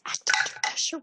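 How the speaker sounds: hushed, voiceless groan of the words; faint, despairing whisper; dismayed whisper. Real, not acted. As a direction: whisper it in dread of the roll. Player reads dreaded move in dismay.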